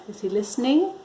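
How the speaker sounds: usual speaking voice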